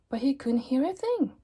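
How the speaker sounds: ordinary delivery, nothing marked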